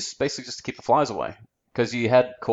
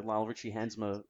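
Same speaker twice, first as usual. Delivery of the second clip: ordinary talk, nothing marked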